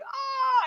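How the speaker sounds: a hammed-up yelp at cold water; mock scream. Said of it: "I was scre".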